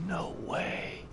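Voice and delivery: breathless whisper of awe; whispered disbelief. Real, not acted. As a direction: whisper it, stunned. Amazed, repeats under breath.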